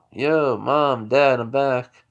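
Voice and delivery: loud homecoming call. Retold or imitated. Son gets back to his place, loudly calling to parents. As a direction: call through the house to the parents. Son calls out on arriving home.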